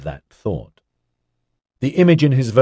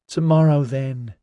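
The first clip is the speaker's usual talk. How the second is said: put-on whiny teenage boy, pitched up; 6 semitones higher than usual. A narrator's reading. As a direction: whine it like a sulky kid. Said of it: "Orion said in a whiny voice".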